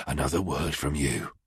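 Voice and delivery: low, quiet menace; a soft low threat; threatening. Acted. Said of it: "he threatened".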